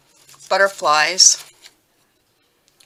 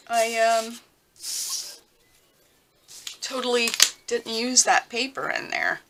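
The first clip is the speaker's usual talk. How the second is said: put-on valley girl voice; 5 semitones higher than usual. Shown as a demonstration. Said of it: "I'm talking like a valley girl".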